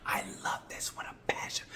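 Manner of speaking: hushed confession; whisper. Real, not acted. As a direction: breathe it like a secret. Confessing his love for MotoGP.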